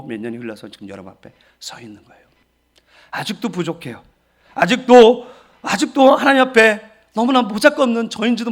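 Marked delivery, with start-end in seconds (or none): none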